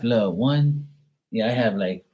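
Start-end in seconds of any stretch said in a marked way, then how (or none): none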